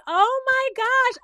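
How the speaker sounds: very high, loud, drawn-out fake excitement; mock amazement. Host mocks the plate made for Shelly, fake amazement. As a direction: gush slowly and high, overdoing the thrill.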